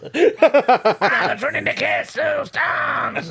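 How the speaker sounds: put-on slow, high, shouted French accent; hammed-up French accent title. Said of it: "You didn't do it in a French accent".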